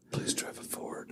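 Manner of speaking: unvoiced whisper, hushed and pleading; whispered plea. Pleading with the tour guide to drive a Ford, in a whisper. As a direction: breathe it like a secret prayer.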